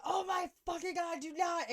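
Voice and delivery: high, loud mock freak-out; geeking out. Driver reacts to his buzzed passenger, mock-panicked. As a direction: imitate a panicking driver, pitch shooting up. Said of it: "he's geeking out".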